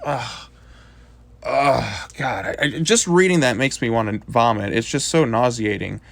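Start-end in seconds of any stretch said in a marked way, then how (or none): 1.4-2.1 disgusted whispered groan. Real, not acted. Disgust at reading dialogue.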